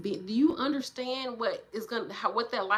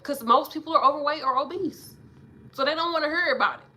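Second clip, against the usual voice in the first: loud rant; 7 semitones higher, 8 dB louder than usual. Real, not acted. Rant rising.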